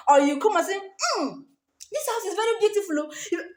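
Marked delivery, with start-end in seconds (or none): 0.8-3.1 high, fast, hammed-up gushing over the house; mock dramatic gushing. Mocking a bride who gushes dramatically at the house. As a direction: gush theatrically about the house. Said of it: "don't be dramatic".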